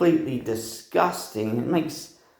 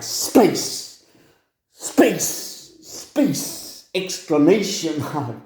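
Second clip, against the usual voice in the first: high, breathy bursts of the one word, each cut off; mock yell in a cell; 4 semitones higher, much slower than usual. Inmate shouting into an echoey cell, a mock yell. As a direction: call it out to hear the echo. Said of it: "it was a really echoey cell".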